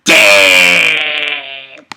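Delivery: loud amazed shout; awed exclamation; amazed damn. Retold or imitated. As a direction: shout it in awe. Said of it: "And I was like".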